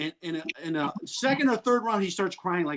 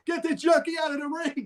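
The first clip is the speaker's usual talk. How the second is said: high mock heckle; retold heckling yell; 9 semitones higher than usual.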